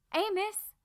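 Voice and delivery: put-on young girl's delighted shout; joyful call. A narrator's reading. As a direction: call out to an old friend. Bertie calls out joyfully.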